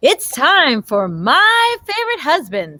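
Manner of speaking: usual speaking voice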